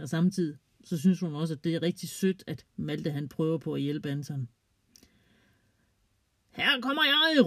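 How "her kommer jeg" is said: high excited yell; shouting. Acted. Anton shouting to the others as he kicks, high excited yell. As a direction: call it out high as he shoots. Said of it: "råber Anton".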